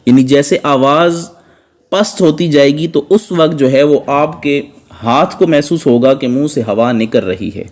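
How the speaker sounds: usual speaking voice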